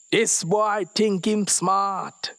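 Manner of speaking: high, slow, loud sneering; mocking sneer. Officer mocking the boy to his partner, sneering. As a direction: drawl out the mockery.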